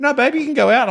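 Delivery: high, laughing imitation of his wife; mock wife voice. Mimicking his wife urging him out to surf, high and laughing. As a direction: squeak it out like a sweet, pushy wife.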